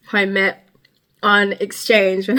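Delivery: usual speaking voice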